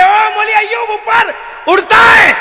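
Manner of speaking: high, slow shouted cheer; crowd cheering. Retold or imitated. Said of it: "ساری دنیا کہے". (ہجوم کی بلند پکار)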